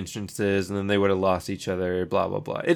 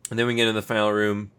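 ordinary delivery

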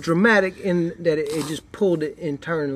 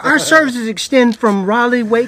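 higher, louder professional phone pitch; customer service voice; 8 semitones higher, 9 dB louder than usual. Retold or imitated. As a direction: rattle it off like a service rep. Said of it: "Customer service voice".

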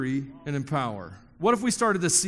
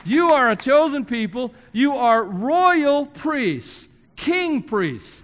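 louder and higher, slow on royal priests; full-force preaching; 4 semitones higher, 5 dB louder, slower than usual. Acted. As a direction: declare it over the congregation.

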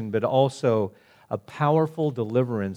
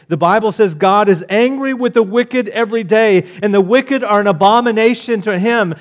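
loud, high-pitched pulpit thunder; full-force hellfire sermon; fiery preaching; 8 semitones higher, 9 dB louder than usual. Acted. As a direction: preach it hard, warning the wicked.

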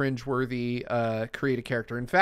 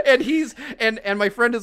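laughing; 9 semitones higher, 6 dB louder than usual. Real, not acted. Still laughing at the memory.

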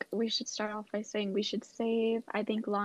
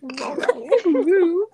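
playful; 7 semitones higher, 11 dB louder than usual. Real, not acted. Playful loud call.

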